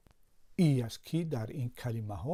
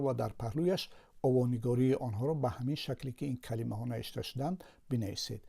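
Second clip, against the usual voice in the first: ordinary talk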